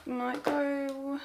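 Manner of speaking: normal talking voice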